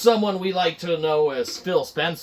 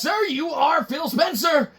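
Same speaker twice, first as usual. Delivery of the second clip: nervous employee; 11 semitones higher than usual. Acted. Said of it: "Guy's like".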